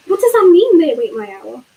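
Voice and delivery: stammered. A narrator's reading. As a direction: stammer it out, rattled. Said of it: "he stammered".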